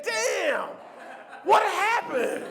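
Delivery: high, slow dazed voice; groggy friend. Retold or imitated. Drunk friend waking next day, high slow dazed voice. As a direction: wake up confused.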